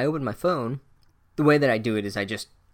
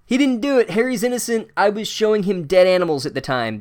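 high, loud, urgent protest; frantic defence; 7 semitones higher, 4 dB louder than usual. Acted. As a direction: burst in pleading a friend's innocence. Hagrid bursts in defending Harry.

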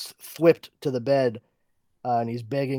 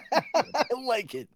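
laughing; 12 semitones higher than usual. Real, not acted. Laughing at the line.

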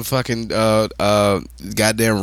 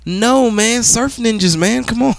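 excited protest; 10 semitones higher, much slower than usual. Real, not acted. Friend brings up Surf Ninjas.

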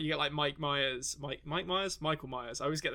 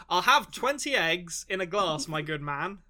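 slow, louder, hammed-up order; pretend bar customer; 2 semitones higher, 4 dB louder, slower than usual. Customer asking a bartender for eggs, mock grand. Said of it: "imagine asking a bartender for that".

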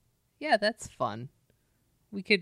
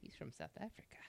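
whispering; 2 semitones lower, 23 dB quieter than usual. Real, not acted. Whispered aside.

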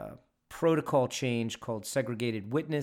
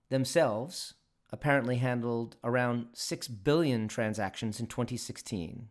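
normal talking voice

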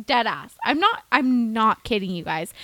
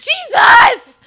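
sudden high shriek of disbelief; shocked shout; 15 dB louder than usual. Real, not acted. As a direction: blurt it out, stunned. Shocked exclamation at friend's story.

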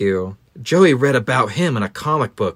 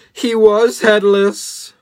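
higher, slow, louder reveal; dramatic reveal; 9 semitones higher, 5 dB louder, slower than usual. A narrator's reading. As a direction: drag out the shock.

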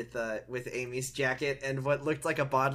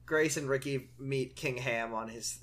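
ordinary talk

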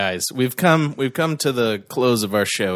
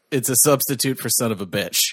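ordinary talk, nothing marked

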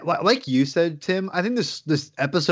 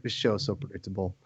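much lower and softer, deadpan muttered verdict; bored grumble; 9 semitones lower, 10 dB quieter than usual. Retold or imitated. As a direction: sink the voice into weary boredom. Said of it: "I'm just like".

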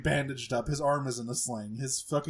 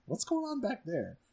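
put-on higher, excited gamer girl; Alexis gamer; 9 semitones higher than usual. Alexis waking from her gaming, a high bright mock voice. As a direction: perk up from the game. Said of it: "It's like".

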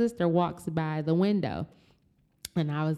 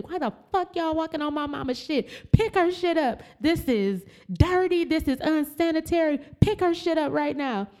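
furious yelling; 11 semitones higher than usual. Retold or imitated. Daughter yelling at hospital staff over mom's clothes, furious high yell. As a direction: let the staff have it at full volume. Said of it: "I'm using F-bombs".

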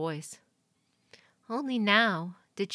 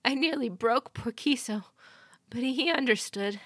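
higher, tearful-edged voice; tearful messenger; 7 semitones higher, 3 dB louder than usual. A narrator's reading. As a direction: hold back tears. Teary messenger.

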